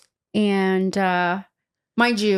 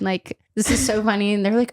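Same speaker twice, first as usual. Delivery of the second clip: usual speaking voice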